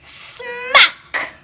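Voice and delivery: sharp high smack; smack sound. A narrator's reading. Shoes hitting the samosa seller's head, sharp smack. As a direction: snap out the impact.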